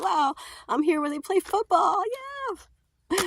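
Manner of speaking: high, excited squeal rising to a cheer; thrilled cheer. Retold or imitated. Teen on the football field, squealing with delight. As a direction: gush like a kid on the field. Standing on the Astrodome AstroTurf.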